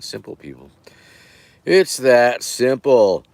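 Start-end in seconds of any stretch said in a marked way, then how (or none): 1.6-3.2 emphatic. Acted. Emphatic repeat of catchphrase.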